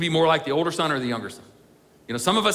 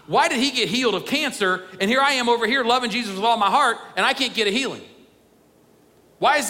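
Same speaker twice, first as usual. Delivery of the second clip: aggrieved complaint; 5 semitones higher than usual. Acted. A faithful believer complaining to God, higher and aggrieved. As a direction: let the resentment build into a plea.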